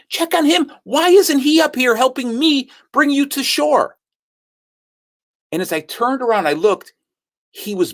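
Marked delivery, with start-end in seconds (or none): 0.1-4.0 high-pitched, indignant disbelief; retold inner protest, pitched high; incredulous. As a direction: question it in exasperated astonishment, voice way up. Indignant thought at wife's request.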